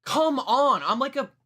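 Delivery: high-pitched exasperation; exasperated. Real, not acted. Exasperated at his own failed prediction.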